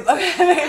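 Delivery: high loud laugh; burst of laughter. Real, not acted. Telling the host about night writing, a burst of laughter.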